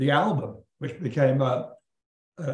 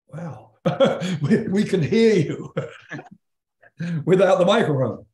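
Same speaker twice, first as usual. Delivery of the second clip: laughing; 2 semitones higher, 3 dB louder than usual. Retold or imitated. Band amazed he sang unmiked.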